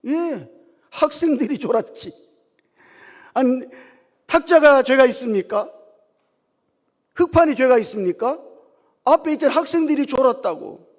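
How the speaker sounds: 높고 아주 크게 내지르는 항변 연기; 답답하다는 듯 호통치듯 외치기; 격앙된 항변. Retold or imitated. 목사와 청년 문답, 격한 항변 연기. (목청껏 따져 묻기)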